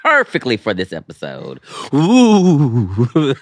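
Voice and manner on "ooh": teasing ooh into laughter; laughing. Real, not acted. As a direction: crack up at your friend. Laughing at friend's slip.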